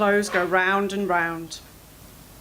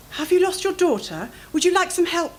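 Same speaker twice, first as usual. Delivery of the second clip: put-on posh accent; 9 semitones higher than usual. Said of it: "She had a really posh accent".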